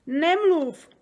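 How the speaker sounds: loud, high plea; unhappy outcry. A narrator's reading. Unhappy giant begs Sofie for silence, crying out. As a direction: cry out in distress. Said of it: "zvolal nešťastný Obrdobr".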